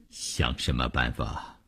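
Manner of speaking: low, soft and hopeless; resigned dismissal. Acted. Husband rejects his wife's plea for a solution, low and resigned. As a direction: drop the voice, give up on it.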